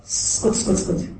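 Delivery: whispered shush, be quiet; hushed shush. Acted. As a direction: hiss them to silence. Voicing people hushing her.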